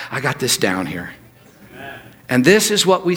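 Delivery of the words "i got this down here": quiet confidence. Retold or imitated. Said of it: "And you go".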